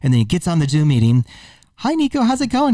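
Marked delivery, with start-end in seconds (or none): 1.8-2.7 high, sing-song teacher hello; cheery teacher greeting. Retold or imitated. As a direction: greet the kid brightly like a teacher. Teacher greeting kid on Zoom.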